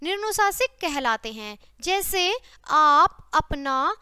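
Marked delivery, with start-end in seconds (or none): 2.7-3.2 आप said slowly and louder, the vowel purely through the mouth; slow oral example. Shown as a demonstration. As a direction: hold the आ in आप clear, no nasal. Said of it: "जिनकी ध्वनि सिर्फ मुंह से निकलती है".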